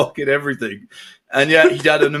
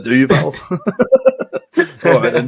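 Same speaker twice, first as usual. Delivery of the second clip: laughing scoff. Real, not acted. Mocking the claim, laughing.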